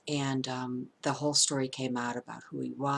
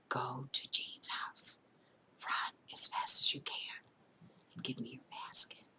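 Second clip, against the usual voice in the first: urgent whisper; 3 dB quieter than usual. Retold or imitated. Mother secretly telling son to run to neighbor's, urgent whisper.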